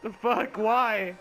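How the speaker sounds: exasperated outburst. Real, not acted. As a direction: yell at the pointless story. Exasperated reaction.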